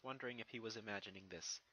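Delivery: ordinary delivery, nothing marked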